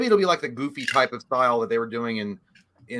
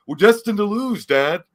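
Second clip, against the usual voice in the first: louder, higher, bad Southern-accent movie line; Keanu Reeves; 6 semitones higher, 9 dB louder, slower than usual. Host as the son addressing his father, bad Keanu Reeves impression. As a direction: overact a doomed son with an awful drawl. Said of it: "that's how Keanu Reeves said".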